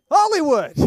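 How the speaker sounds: loud, high, dramatic "Hollywood."; mock grand exclamation. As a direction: announce "Hollywood." like a showman. Ironic exclamation, repeated plainly after.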